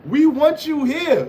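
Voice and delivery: high, slow mock plea; mock pleading. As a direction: beg him to stay, hammed up. Mocking troll, begging him stay.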